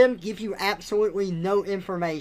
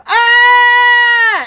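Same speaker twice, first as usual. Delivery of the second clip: loud, high-pitched mock scream; mock death scream; 17 dB louder than usual. Audio log victims being killed, mock dying scream.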